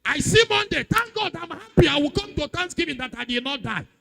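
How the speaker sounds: high, shouted sermon delivery; full-force preaching. Acted. Preacher proclaims thanks for not dying, full-force shout. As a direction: shout it out to the whole congregation.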